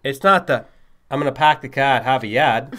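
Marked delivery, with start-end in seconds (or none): 1.1-2.8 mock over-the-top Boston accent, dropped r's, a bit louder. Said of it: "has that really over-the-top accent?".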